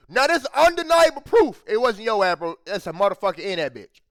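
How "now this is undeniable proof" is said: emphatic. Real, not acted. Emphatic point about the story.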